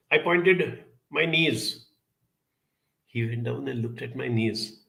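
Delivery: normal talking voice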